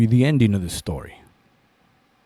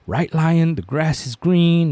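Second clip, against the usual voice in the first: higher, insistent appeal; lightly voiced pleading tiger; 6 semitones higher, 4 dB louder than usual. Tiger pleads his case to the lion, lightly insistent. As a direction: argue your case, pitched up a bit.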